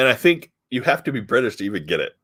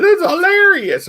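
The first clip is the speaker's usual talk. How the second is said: put-on very high, loud kid voice; mock young kid, shooting way up high; 6 dB louder than usual. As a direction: squeal it like an over-amused youngster. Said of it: "the young kid's like".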